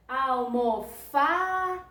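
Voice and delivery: Almofada slowly called out, FA held long; stressed syllable drawn out. Shown as a demonstration. Teacher helping pupils find the tonic syllable, FA drawn out. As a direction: stretch the FA in Almofada as if calling it. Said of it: "que foi mais demorada".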